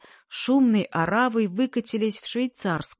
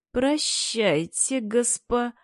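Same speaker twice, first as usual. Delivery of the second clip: slow, rising прощайте, cut off; прощайте slowed and lifted, broken off; forced smile goodbye; 5 semitones higher, much slower than usual. A narrator's reading. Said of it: "подобие улыбки". (натянутая улыбка)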